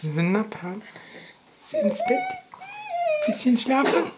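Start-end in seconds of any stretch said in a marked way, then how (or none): 1.6-4.1 put-on baby talk. Talking to baby.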